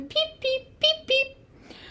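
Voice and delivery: robin peeping. A narrator's reading. Robin in the noisy recap, small high peeping. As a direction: peep high and small in pairs. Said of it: "The robin".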